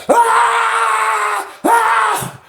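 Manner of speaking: high drawn-out mock shrieks; resume wailing after a breath; imitated screaming. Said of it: "take a breath from screaming".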